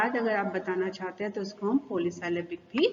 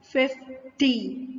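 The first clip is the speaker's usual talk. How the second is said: "Fifty" slowly as फिफ, pause, टी; split into syllables; 3 semitones higher, much slower than usual. Shown as a demonstration. Teacher counting two syllables for students, slowly split. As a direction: break "fifty" into two slow beats. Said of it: "दो syllable हो गए".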